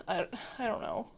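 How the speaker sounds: usual speaking voice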